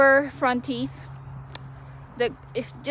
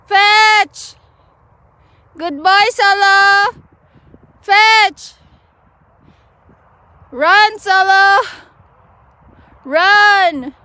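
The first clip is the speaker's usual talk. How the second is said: loud, high calls to the dog; calling the dog; 8 semitones higher, 10 dB louder, much slower than usual. Real, not acted. Owner calling her dog Solo to fetch, loud and high. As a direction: cheer the dog on across the yard.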